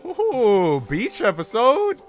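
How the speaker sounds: high-pitched, loud gleeful squeal; excited squeal. Real, not acted. Streamer discovering a beach episode, excited squeal. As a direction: squeal with rising delight at a treat.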